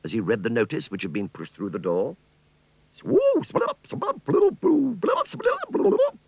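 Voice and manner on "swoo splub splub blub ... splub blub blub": very high squeaky bubbling babble; alien gibberish. A narrator's reading. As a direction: burble like a tiny alien. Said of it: "said the little green man".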